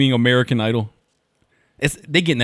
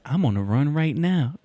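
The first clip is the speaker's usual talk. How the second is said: put-on slow, higher-pitched kid boast; imitated Baltimore kid; 4 semitones higher, slower than usual. Retelling another kid from the video, imitated boast. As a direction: draw it out like the kid. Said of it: "the other kid said".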